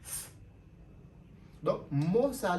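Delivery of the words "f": "F" as a soft breathy f sound. Shown as a demonstration. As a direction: blow out "F" for th. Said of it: "T H".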